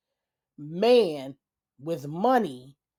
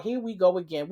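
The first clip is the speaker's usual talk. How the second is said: ordinary talk, nothing marked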